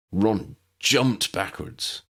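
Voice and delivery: ordinary delivery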